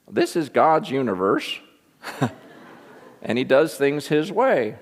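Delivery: put-on scratchy old preacher with a swooping lilt; old preacher impression, pitch swinging up and down; J. Vernon McGee. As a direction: imitate a little old man's scratchy voice. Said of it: "scratchy voice".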